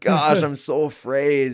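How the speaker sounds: mock-anxious inner voice; acted fear. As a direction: voice the fearful inner thought out loud. Voices anxious inner self-talk.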